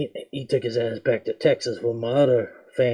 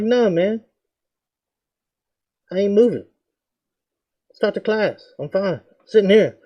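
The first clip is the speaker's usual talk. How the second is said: higher, cocky, unbothered refusal; defiant refusal; 7 semitones higher than usual. Retold or imitated. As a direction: brush the teacher off, cocky and higher.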